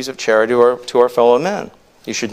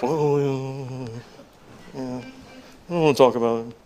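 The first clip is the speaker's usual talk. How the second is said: mock embarrassed; 8 dB quieter than usual. Husband asked whether he has a wife, acted embarrassment. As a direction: shrink away from the question, much softer. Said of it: "if we were that embarrassed about our spouses".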